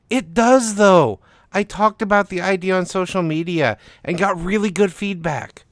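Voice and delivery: high, loud, eager insistence; insistent protest. Acted. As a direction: push back hard and bright.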